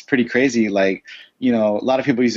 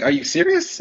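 high incredulous question; high-pitched are you serious. Retold or imitated. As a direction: shoot up in disbelief on serious. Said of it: "it's like".

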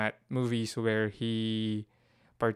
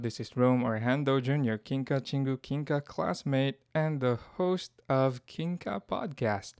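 ordinary delivery

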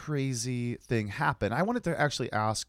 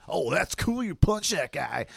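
higher-pitched, fake impressed reaction; mock approval; 5 semitones higher, slower than usual. Passenger pretending to admire the driver, mock-impressed. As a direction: imitate gushing approval, drawing out the end. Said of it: "I'm not trying to be like".

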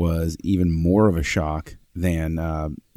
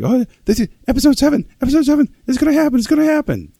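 put-on much higher and fast, squealing with boyish glee; squeals of glee; 7 dB louder, faster than usual. Fan running around telling coworkers, high gleeful squeal. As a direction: gush it out like a thrilled kid. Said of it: "boy-like squeals of glee".

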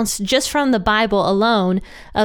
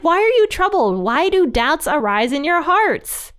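high-pitched, playful chiding; exasperated Jesus; 7 semitones higher than usual. Retold or imitated. Jesus to troubled disciples, lightly exasperated.

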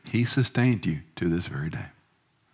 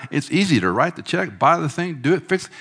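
rising emphatic; 8 semitones higher than usual. Real, not acted. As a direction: build it up fast and eager. Preacher building about easy fixes.

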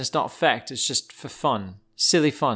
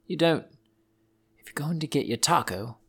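ordinary delivery

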